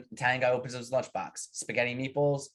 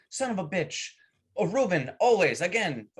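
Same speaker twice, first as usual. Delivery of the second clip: exasperated Polish worker; 6 semitones higher than usual. Acted. Polish worker complains in joke.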